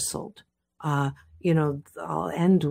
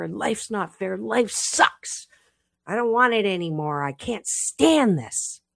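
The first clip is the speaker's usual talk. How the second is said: much higher, bursting rock's rant; angry outburst; 6 semitones higher than usual. Acted. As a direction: blow up at life, voice cracking high. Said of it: "it just blows up and says".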